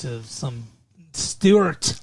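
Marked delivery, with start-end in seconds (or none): 1.1-2.0 Stewart in a mock Scottish burr; Scottish accent. Host naming Brenda's fiancé in a mock Scottish accent. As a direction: say Stewart like a Scotsman. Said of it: "you or Sean Connery?".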